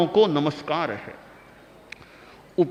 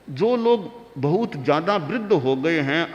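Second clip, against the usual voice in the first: ordinary talk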